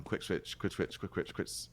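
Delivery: very soft, low rapid repetition; fast tongue-twister. Shown as a demonstration. Caster testing a hard phrase, fast tongue-twister. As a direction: rattle it off under your breath. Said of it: "Say that ten times faster".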